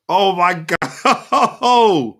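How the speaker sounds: high, very slow "oh my God" broken by a laugh; laughing disbelief. Real, not acted. Fan at the screen laughing at the sending off in disbelief.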